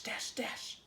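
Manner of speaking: breathy whispered dashes. A narrator's reading. Reader voicing the bunnies in a whisper.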